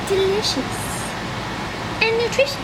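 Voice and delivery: bright, high and loud; cheery presenter voice. Acted. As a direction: sell it like a cooking show host.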